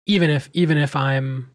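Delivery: usual speaking voice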